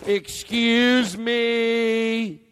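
high, slow, overly polite; prissy customer voice. Acted. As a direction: ask sweetly like a fussy diner. Polite diner asking for soup.

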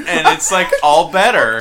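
ordinary talk

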